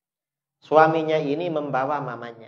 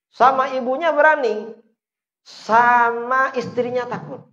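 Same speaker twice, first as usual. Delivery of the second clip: higher, louder mock outrage at such sons; mocking exclamation; 8 semitones higher, 6 dB louder than usual. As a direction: throw the line up as a jab at the congregation. Preacher mocks a son afraid of his wife. (sindiran dengan suara tinggi dan keras)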